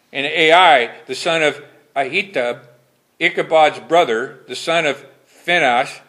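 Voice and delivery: solemn pulpit reading of scripture. Acted. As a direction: read the King James verses with solemn weight. Preacher reads scripture aloud.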